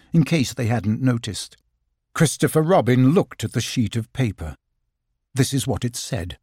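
ordinary delivery

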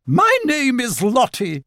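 high, grand self-introduction; haughty announcement. A narrator's reading. Otter introducing herself to gathered animals, haughty. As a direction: proclaim the name in a high, showy voice. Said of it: "she announced".

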